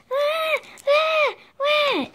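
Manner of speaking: high, loud fake baby cries; baby wailing. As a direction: wail like a crying baby. Doll crying again.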